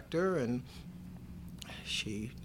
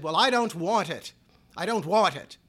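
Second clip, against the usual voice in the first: loud refusal; 5 semitones higher, 8 dB louder than usual. Retold or imitated. Mother refusing wooden casket.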